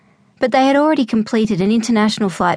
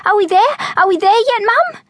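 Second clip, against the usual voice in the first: much higher copycat squeal of excitement; excited mimicry; 13 semitones higher than usual. A narrator's reading. Excited boy copying his sister to their mum. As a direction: echo your sister, just as thrilled. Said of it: "Robert mimicked his sister, equally excited".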